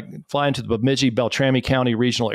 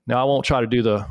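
ordinary delivery

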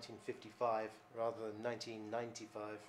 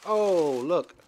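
much louder and higher burst of surprise; sudden loud surprised oh; startled exclamation; 7 semitones higher, 21 dB louder than usual. Real, not acted. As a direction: jump at what you just found. Spots the dead cat up close.

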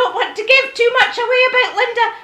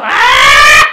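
grudge growl; 12 semitones lower, 8 dB louder than usual. Retold or imitated. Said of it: "I used to go".

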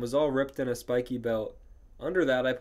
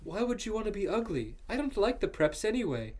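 higher, put-on boy character voice; Draco's answer; 9 semitones higher than usual. A narrator's reading. Draco answering Ebony about being ugly, higher put-on voice. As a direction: play him in a raised pitch. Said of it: "answered Draco".

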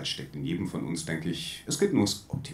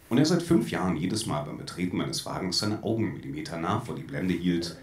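usual speaking voice

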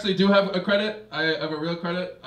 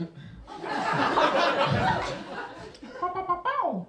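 high, frantic yapping dog noises; mock panting pup in heat; 13 semitones higher than usual. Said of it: "my impersonation of Blues from Blue's Clues, going into heat".

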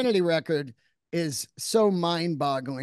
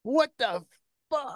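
retold disbelief; 7 semitones higher than usual. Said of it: "I was like".

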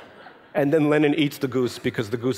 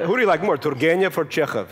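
higher, louder mock-serious quiz; a hammed-up stern Russian grandmother; mock Russian grandma; 4 semitones higher, 3 dB louder than usual. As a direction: ham up a Russian granny's test. Mocking typical Russian question.

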